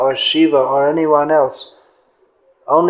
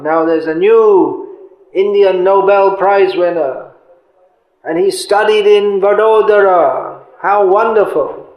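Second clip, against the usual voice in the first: raised, mock-proud announcement; sarcastic delight; 4 semitones higher than usual. Preacher mocking pride in a local prize winner, sarcastic delight. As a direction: gush with exaggerated pride you don't mean. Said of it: "I'm being sarcastic".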